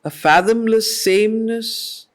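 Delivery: ordinary delivery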